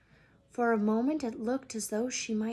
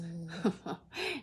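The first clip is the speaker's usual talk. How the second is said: chuckle; 3 semitones lower, 5 dB quieter than usual. Acted. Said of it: "He chuckled at his own joke".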